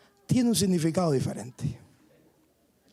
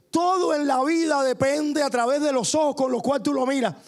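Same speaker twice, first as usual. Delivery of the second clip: rising sermon fervor; 7 semitones higher than usual. Acted. Preacher urging the congregation to see through faith, fervently. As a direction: preach it out with growing conviction.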